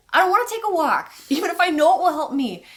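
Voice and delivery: high, quick protest at full voice; whiny reluctance. Acted. Acts out own reluctance.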